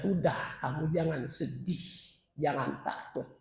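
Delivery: soft, tender reassurance; gentle angel. Acted. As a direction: comfort the dying softly. Angel comforts the dying. (malaikat menghibur dengan lembut)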